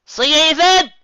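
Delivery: high, loud call ahead; shout. A narrator's reading. Megan to Dash ahead, a loud high shout. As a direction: call out down the path. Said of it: "she shouted".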